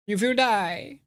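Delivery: high, theatrical villain voice; hammy high-pitched villain; villain threat. Pretend. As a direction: pronounce doom in a put-on high voice. Acting game villain taunting.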